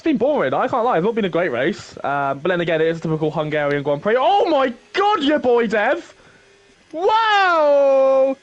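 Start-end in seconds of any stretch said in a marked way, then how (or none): 4.2-8.4 surprised. Real, not acted. Shock at a wild overtake.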